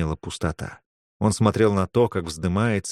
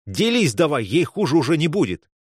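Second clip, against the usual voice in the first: higher, pressing demand; urgent demand; 6 semitones higher than usual. Acted. Young man pressing a mage to share, urgent demand. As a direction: push them hard to hand it over.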